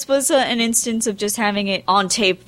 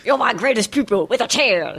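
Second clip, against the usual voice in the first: lightly hammed-up mock Chinese accent, higher pitched; mock Chinese old man; 4 semitones higher than usual. Joining in on the narrator impression, mock Chinese old man. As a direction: play along with a caricature Chinese master voice. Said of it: "voices that Andrew and I do".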